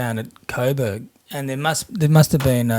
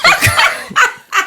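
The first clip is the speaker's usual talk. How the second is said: a high, loud burst of laughter; squealing laugh; 7 dB louder than usual. Real, not acted. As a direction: crack up mid-story.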